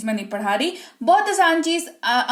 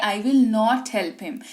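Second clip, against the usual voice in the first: very slow demo; much slower than usual. Shown as a demonstration.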